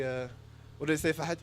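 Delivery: usual speaking voice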